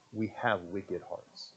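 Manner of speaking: low, quiet conviction; solemn hush. Real, not acted. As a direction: let it sink in quietly. Solemn quiet after yelling.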